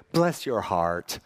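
low, slow, drawn-out sympathy; polite Texan put-down. Retold or imitated. Texas churchgoers judging the guest preacher's sermon, politely veiled put-down. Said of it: "the way they'd put it is".

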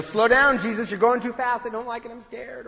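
nervous passenger begging the driver; anxious backseat driver. Retold or imitated. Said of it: "resisting the urge to backseat drive".

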